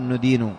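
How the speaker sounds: "ندين" with u in the first syllable, "nudinu"; the mistaken "nudinu" reading of "ندين"; damma on the nun. Shown as a demonstration. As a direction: say "ندين" starting with "nu". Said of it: "بالضم خطأ".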